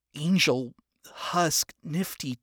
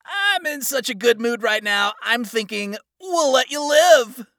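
loud, higher, jovial gloat; smug villain; 10 semitones higher, 9 dB louder than usual. Acted. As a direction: crow like a victor.

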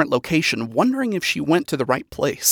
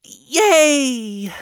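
high, chipper voice falling short of real excitement; raised pitch, weakly convincing cheer; forced enthusiasm; 7 semitones higher than usual. A narrator's reading. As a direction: try hard to sound enthusiastic. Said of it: "tried her best to sound enthusiastic".

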